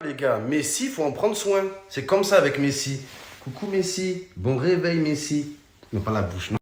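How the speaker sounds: put-on soft, low cooing to a baby; whispering over a crib. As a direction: coo gently at a sleeping baby.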